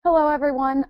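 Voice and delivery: ordinary talk, nothing marked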